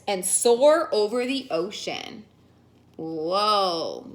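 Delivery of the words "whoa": awed whoa. Real, not acted. Reader's amazed aside.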